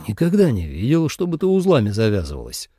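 surprised. A narrator's reading. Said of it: "удивлённо изрёк Ли Цзэ".

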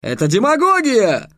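teacher switching to the dead king's defender, outraged; outraged wail. A narrator's reading. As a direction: howl it in a new voice. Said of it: "другим голосом возопил".

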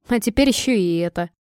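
plain reading voice

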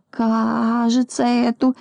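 очень медленно, высоко, растягивая слова; признавайся нехотя, мямля; робкое признание. A narrator's reading. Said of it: "при-, признался Пончик". (виновато протянуть)

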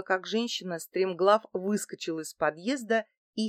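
plain reading voice

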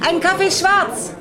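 ordinary talk

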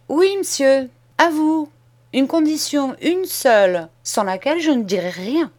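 put-on a boy laying out terms, steady and sure; bold schoolboy. Boy insists on his condition.

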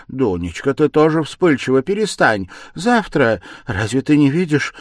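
high, loud, frightened urging; anxious, scolding plea; alarmed mother. A narrator's reading. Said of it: "перепугалась мать".

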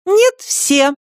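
high, firm insistence; a girl refusing to budge; stubborn insistence. A narrator's reading. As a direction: dig in your heels. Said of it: "стояла на своём Катя".

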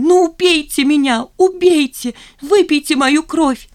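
high, loud melodramatic wail; hysterical shriek. A narrator's reading. Zina daring the office to kill her, shrieking hysterically. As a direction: shriek it like a martyr. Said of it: "взвизгнула Зина".

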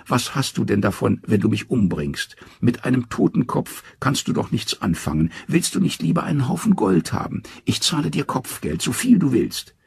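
high, coaxing trickster; Loki, wheedling. Acted. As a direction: sweet-talk him in a high voice. Loki bargains for his head.

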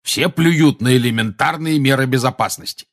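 put-on indignant old wizard growling; angry growl. A narrator's reading. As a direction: growl your complaint indignantly. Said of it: "прорычал Грозный глаз".

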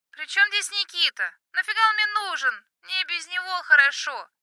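high, loud yell into the phone; shouting over music. Acted. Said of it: "истерически засмеялась".

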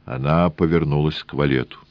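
ordinary delivery

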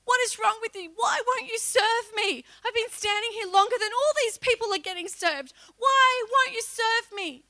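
high, loud, pleading; acted-out desperate plea. Acting out the dream, crying out to God, high and loud. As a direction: cry out, slowing on the last line. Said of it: "This is what I did in my dream".

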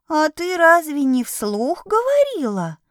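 high little kitten voice. A narrator's reading. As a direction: innocent squeaky kitten question. Kitten asks innocently.

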